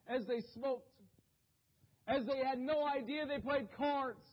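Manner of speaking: high, loud, slow preaching build; impassioned preaching. Acted. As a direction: paint the scene with rising passion.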